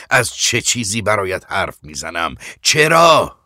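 measured rebuke rising to a loud cry; anguished accusation climbing to a shout; building reproach. Acted. Old man reproaches, building to outcry.

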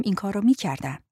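ordinary delivery, nothing marked

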